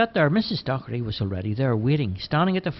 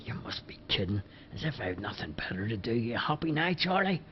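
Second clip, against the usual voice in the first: muttered much softer, half whispered; under his breath; 7 dB quieter than usual. A narrator's reading. Bobo grumbling to Charlie out of earshot, under his breath. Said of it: "under his breath he said".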